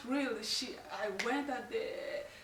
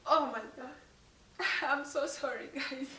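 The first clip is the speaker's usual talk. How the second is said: tearful laughing apology; 3 semitones higher than usual. Real, not acted. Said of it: "This is so emotional".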